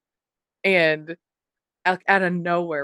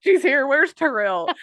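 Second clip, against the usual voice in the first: high, hushed-up-no-more mock excitement; excited recognition; 7 semitones higher, 4 dB louder, slower than usual. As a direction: pass on the news in playful alarm. Acted thrilled recognition of the woman.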